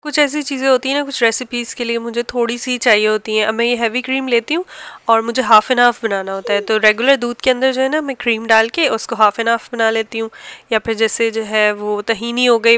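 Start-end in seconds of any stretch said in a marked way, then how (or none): none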